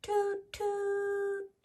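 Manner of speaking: high, held horn toot; train horn toot. A narrator's reading. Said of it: "honk the horn".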